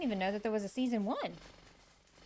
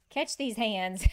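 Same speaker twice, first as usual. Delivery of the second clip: louder, playful tough-guy challenge; mock threat; 10 dB louder, slower than usual. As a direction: playfully dare them to fight.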